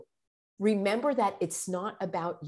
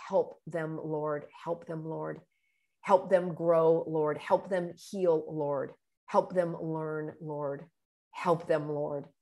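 soft, slow murmured prayer; silent prayer; 3 semitones lower, 4 dB quieter, slower than usual. Shown as a demonstration. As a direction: pray quietly and slowly. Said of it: "I will actually do a silent prayer".

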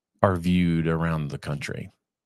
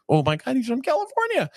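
high-pitched delighted squeal, fast; squealing girl; faster than usual. Retold or imitated. Girl in a car hears he's from California, squealing with delight. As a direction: squeal it like a thrilled teenage girl. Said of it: "just squealed".

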